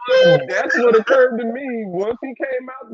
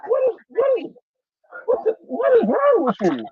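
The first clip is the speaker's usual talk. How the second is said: high, incredulous mock question; hammed-up disbelief, pitched up; 10 semitones higher than usual. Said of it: "I'm like".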